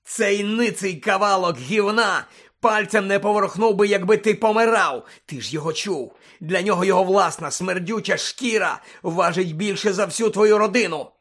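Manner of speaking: loud, high, contemptuous rant; raging denunciation; furious tirade. Acted. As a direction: spit out the accusations at full voice.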